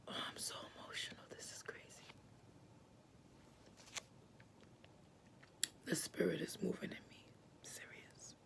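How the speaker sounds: whispered, barely audible, overcome with feeling; hushed emotional whisper. Retold or imitated. Friend overwhelmed in the night, whispering. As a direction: breathe it out as if the spirit has taken hold.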